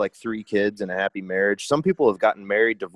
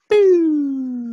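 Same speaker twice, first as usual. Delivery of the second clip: a high, louder, hammed-up nurse "boo"; mock nurse; 6 dB louder than usual. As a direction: toss off a silly high nurse voice. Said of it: "The nurse was probably like".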